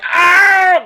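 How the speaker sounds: high, loud mock scream of pain; retold pained scream. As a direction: yelp as if your hand got hit. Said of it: "they were like".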